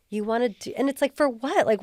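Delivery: normal talking voice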